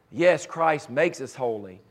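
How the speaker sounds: normal talking voice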